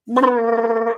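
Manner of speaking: loud, high trilled motor brrr; imitated saw noise. Shown as a demonstration. Not afraid of the saws and blades, imitated saw buzz. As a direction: roll out a sustained machine buzz.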